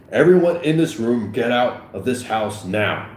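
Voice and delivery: loud order, slowing to a firm close; police command to a crowd; commanding officer. Acted. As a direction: clear the room with authority. Officer orders the room cleared.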